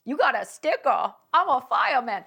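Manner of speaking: loud mock little-kid boast; four-year-old voice. Comic imitating a kid with a sticker, four-year-old voice. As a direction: play a proud preschooler. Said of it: "like, a four-year-old in there".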